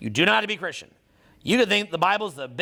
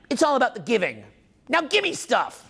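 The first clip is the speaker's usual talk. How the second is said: mocking greedy people; 4 semitones higher than usual. Speaker mimics people preaching giving while grabbing, mocking high-pitched demand. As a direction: imitate a hypocrite, pitch jumping up on the demand. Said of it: "That's what a lot of people do".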